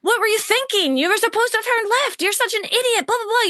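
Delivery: high-pitched, exasperated mock rant; mock angry. Coach imagines client angry at a wrong turn, mock angry. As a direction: scold in a hammed-up angry voice. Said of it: "get angry at him and be like".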